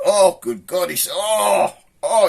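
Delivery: loud, high cry of disgust; horrified recoil. Acted. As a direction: reel back from an awful stench. Acted recoil from bad smell.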